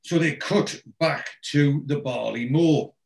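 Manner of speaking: ordinary delivery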